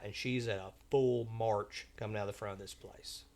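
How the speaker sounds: normal talking voice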